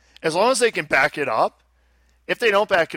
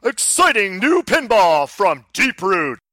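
monster truck ad; 5 semitones higher, 4 dB louder than usual. Acted. Event ad tagline.

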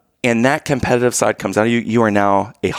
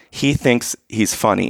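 ordinary talk, nothing marked

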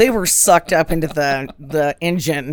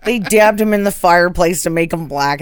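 ordinary delivery

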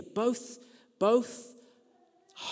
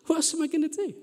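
same question pitched much higher, pleading; rising despair; 11 semitones higher, faster than usual. Retold or imitated. Vicar repeating his question to the congregation, higher and pleading. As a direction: throw it up high like a helpless plea.

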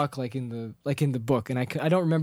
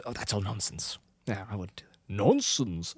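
muttering Bilbo; 6 semitones lower, 5 dB quieter than usual. Retold or imitated. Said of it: "Bilbo goes".

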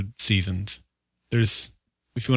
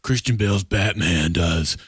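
gruff whisper; 5 semitones lower than usual. Shown as a demonstration. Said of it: "why does Reese talk like this".

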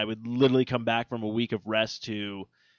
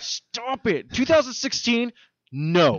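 high, rising exasperated outburst; comic indignation, pitched way up; mock scolding; 10 semitones higher, 3 dB louder, much slower than usual. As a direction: tell off a misbehaving year.

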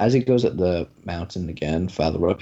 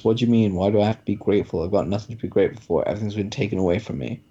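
ordinary delivery

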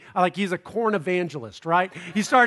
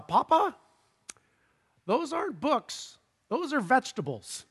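put-on light child voice, higher; higher child's voice, a hint of patient correction; little son's voice; 5 semitones higher, 3 dB quieter than usual. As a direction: play the small son gently setting Papa straight. Son corrects his father.

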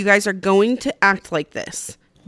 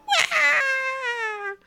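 high mock baby crying wail; imitated toddler wail; 5 dB quieter than usual. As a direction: wail like a four-year-old denied his treat. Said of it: "And he's like".

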